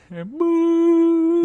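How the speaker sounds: high, held "boo" like a tone; long steady bleep-like hoot; imitated tone. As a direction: hold a loud high-pitched boo. Imitating what Balthazar hears.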